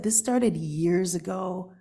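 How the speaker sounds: ordinary talk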